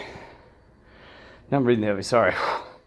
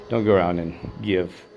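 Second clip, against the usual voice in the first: usual speaking voice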